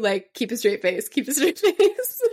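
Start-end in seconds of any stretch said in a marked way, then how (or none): 1.1-2.2 higher, louder, breaking into a laugh. Pretend. Friend joking about a player on camera, laughing. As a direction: crack up partway through the words.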